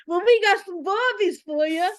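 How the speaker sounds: mock Australian accent to a friend; bogan Australian accent. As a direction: keep the mock Aussie bit going. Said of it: "Pretend you're a bogan Australian".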